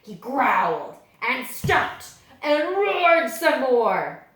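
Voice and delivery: slow, loud, growly storytelling; loud, slow bear-style narration; growling narration. A narrator's reading. Narrating the librarian's bear act.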